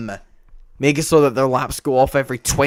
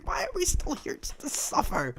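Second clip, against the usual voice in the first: dramatic despair; 14 semitones higher, 11 dB quieter than usual. Pretend. Despairing meme quote.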